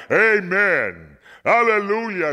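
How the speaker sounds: high, ringing amen and hallelujah; crying out in praise. A narrator's reading. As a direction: cry it aloud in worship. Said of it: "And they too cried".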